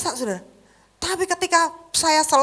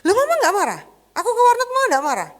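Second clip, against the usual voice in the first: put-on much higher, fast astonished teen; son's surprised voice; 9 semitones higher, 4 dB louder, faster than usual. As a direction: mimic the son's disbelief. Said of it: "Kok kaget". (anak heran dengan suara tinggi)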